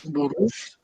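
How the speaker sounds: Burroughs repeated softly as "bu-rus"; tentative repetition. Real, not acted. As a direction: try out Burroughs quietly, with Spanish u vowels. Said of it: "¿Se pronuncia así?". (Burroughs repetido bajito como «burús»)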